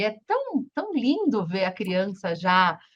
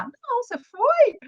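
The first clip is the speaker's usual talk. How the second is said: put-on little girl's reply; 12 semitones higher than usual. Daughter's reply voiced.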